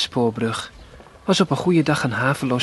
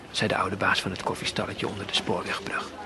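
ordinary delivery, nothing marked

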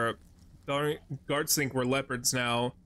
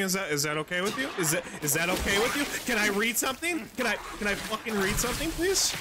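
higher and faster, building to a squeaky, strained "please?"; exasperated pleading; 8 semitones higher than usual. Real, not acted. The player keeps getting talked over and builds from pleading to exasperation. Teammates keep talking over him.

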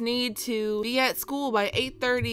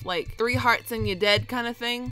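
usual speaking voice

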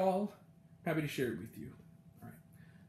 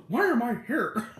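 Lily Tomlin; 4 semitones higher than usual. Retold or imitated. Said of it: "great Lily Tomlin impression".

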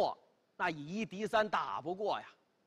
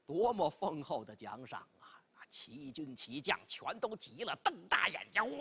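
usual speaking voice

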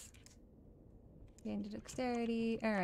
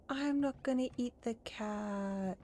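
playful protest; much slower than usual. Pretend. Player protests as the dinosaur.

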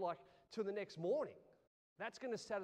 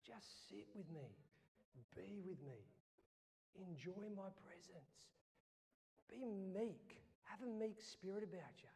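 God's gentle voice; 3 semitones lower, 11 dB quieter than usual. Retold or imitated. God inviting the pastor to sit with him, softly. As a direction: speak it like a soft invitation to rest. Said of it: "He goes".

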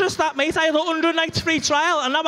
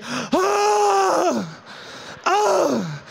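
relieved climax shout; 5 semitones higher than usual. Retold or imitated. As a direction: roar it out in pure relief. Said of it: "so fucking relieved that it's over".

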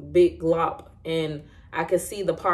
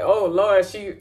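lightly voiced panicking husband, higher; a hint of a guy who doesn't know what to do, pitched up; mock-flustered husband crying oh no, higher; 5 semitones higher, 3 dB louder than usual. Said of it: "So you know how guys get".

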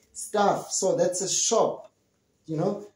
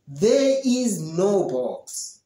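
slow clear answer; much slower than usual. Shown as a demonstration. Teacher models the correct answer slowly for students. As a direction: spell out each word slowly. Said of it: "but you say".

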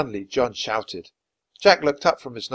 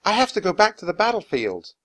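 frantic; 9 semitones higher, 5 dB louder than usual. Acted. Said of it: "He sounded frantic".

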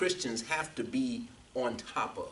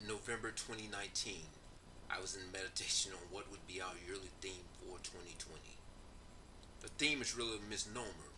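subdued reading with little pitch movement; prepared introduction read aloud; 8 dB quieter than usual. Real, not acted. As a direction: read it quietly and evenly, like a recorded testimony.

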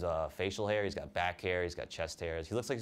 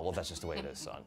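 dad voice; 7 semitones lower, 5 dB quieter than usual. Retold or imitated.

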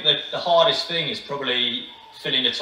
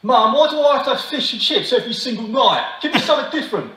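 high-pitched, hammed-up British complaint; exaggerated British kid's protest; British accent; 9 semitones higher, 3 dB louder than usual. Shown as a demonstration. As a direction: whine to Mum in a British accent. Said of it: "In, in British".

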